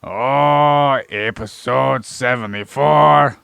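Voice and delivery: loud, drawn-out show opener; belted episode announcement. Acted. Host announces the episode to listeners, loud and drawn-out. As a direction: stretch it out like a ring announcer.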